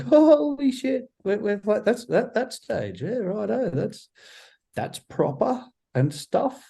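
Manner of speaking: high-pitched mock awestruck kid; little kid self. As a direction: imitate your amazed child self, voice way up high. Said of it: "little kid [name], like".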